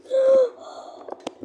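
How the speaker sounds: sharp high frightened gasp; startled gasp. Real, not acted. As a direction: gasp from a jump scare. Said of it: "That jump scared me so much".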